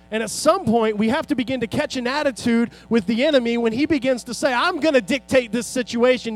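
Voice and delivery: loud, high, driving sermon climax; full-force preaching. Acted. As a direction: preach it out to the whole congregation.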